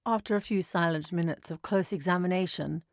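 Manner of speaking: ordinary delivery, nothing marked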